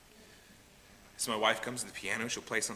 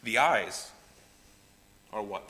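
ordinary talk

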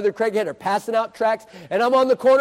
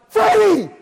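high, loud startled holler; excited shout; 11 semitones higher, 7 dB louder than usual. Retold or imitated. Preacher suddenly spots Freddie, shouting his name. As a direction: burst out with the name.

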